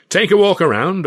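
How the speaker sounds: loud, booming impresario selling a vision; grand showman Carno. Acted. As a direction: proclaim it like a proud showman to his company. Carno invites the troupe.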